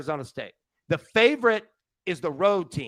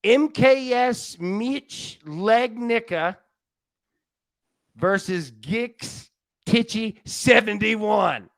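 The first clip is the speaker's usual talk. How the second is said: slow careful reading; 4 semitones higher, much slower than usual. Real, not acted. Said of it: "fun thing for the chat".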